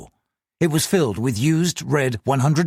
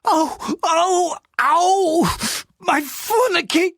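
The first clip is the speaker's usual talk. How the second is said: high, yelping cries of pain; howl of pain; much slower than usual. Acted. Clerk howls in pain after his fingers are slammed in a drawer. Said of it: "he let out a howl of pain".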